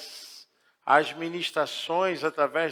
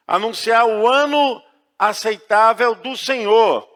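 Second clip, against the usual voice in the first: raised, emphatic pulpit reading; proclaimed scripture reading; 5 semitones higher, 8 dB louder than usual. Real, not acted. Pastor reads Luke to the congregation, proclaiming it emphatically. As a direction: declaim the verse, building to the final phrase.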